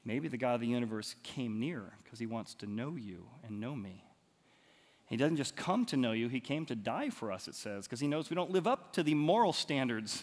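usual speaking voice

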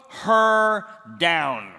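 loud, very slow drawn-out command; commanding order. Acted. King ordering eunuchs to throw her down, loud slow command. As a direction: drag the order out, loud and heavy. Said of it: "He looks at the eunuchs and says".

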